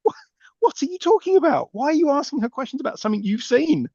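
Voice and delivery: high-pitched mock exasperation; incredulous. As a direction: challenge the character in disbelief. Mock scolding of the character.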